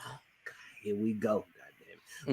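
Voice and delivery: soft, sinking mutter under the breath; weary mutter. Retold or imitated. Fan with bad knees grumbling about heading back down, muttered softly. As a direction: grumble it to yourself, resigned. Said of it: "I was like".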